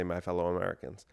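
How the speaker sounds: soft, mocking the corny opener; dismissive imitation of a politician's line; a quiet sneer at the stock phrase. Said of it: "that's corny when they say".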